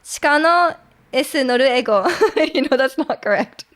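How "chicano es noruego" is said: Japanese-accented Spanish, vowels added between consonants in "es noruego"; full Japanese pronunciation. Shown as a demonstration. Showing what would not be correct, full Japanese accent. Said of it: "I won't go full Japanese".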